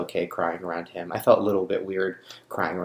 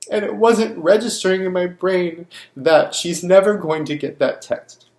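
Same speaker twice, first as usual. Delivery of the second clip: high, loud, overwrought with grief; anguished, raised voice near tears; real grief; 8 semitones higher, 6 dB louder than usual. Real, not acted. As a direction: pour it out in distress, pitch climbing. Grieving a friend just killed.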